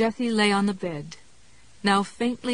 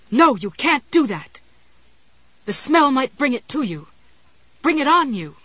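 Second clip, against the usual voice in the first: higher, louder panicked warning; alarmed; 6 semitones higher, 7 dB louder than usual. Acted. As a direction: plead urgently, full of alarm. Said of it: "it was full of alarm".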